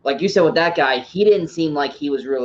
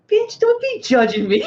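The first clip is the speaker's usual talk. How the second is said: much higher, slow, sassy retort; sassy comeback; 3 dB louder than usual. Retold or imitated. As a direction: camp it up like a diva. Acted retort to his dad.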